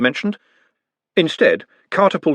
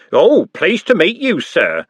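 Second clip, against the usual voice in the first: slow, higher prissy imitation; a hammed-up mimic of Nutt; mocking mimicry; 8 semitones higher, 5 dB louder, slower than usual. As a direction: ape his politeness cruelly. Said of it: "Andy mimicked".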